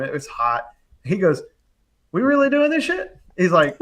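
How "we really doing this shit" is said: high-pitched, louder disbelieving question; incredulous cousin. Retold or imitated. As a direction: imitate a doubtful cousin, pitched way up. Doubting cousin questioning the job.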